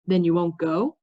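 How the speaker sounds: usual speaking voice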